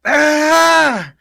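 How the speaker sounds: put-on sudden loud high drunken yell; loud drunken shout. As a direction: blurt out a sudden startled shout. Drunk man suddenly recognizes her.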